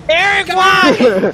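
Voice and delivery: slow, high wailing why; anguished shout. Real, not acted. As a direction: wail at the teammate.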